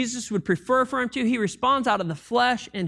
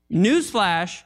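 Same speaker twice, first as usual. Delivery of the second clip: ordinary talk, nothing marked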